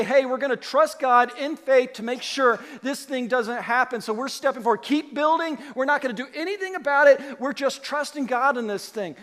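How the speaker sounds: rally the workers boldly; bold faith. Retold or imitated. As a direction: Nehemiah declaring trust to the workers, loud and eager. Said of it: "Shouldn't he just say".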